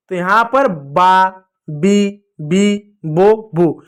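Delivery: बा, बी, बो, बू said slowly, one at a time; teacher slowly sounding out बा, बी, बो, बू. Shown as a demonstration. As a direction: sound out each syllable slowly for the class. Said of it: "उच्चारण है अलग अलग तरीके से".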